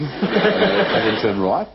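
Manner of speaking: slow, with RIGHT rising like a question; question intonation. Shown as a demonstration. Giving someone confusing directions, rising question intonation. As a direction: stretch it out and lift "right" questioningly. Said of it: "sounds as if it's a question".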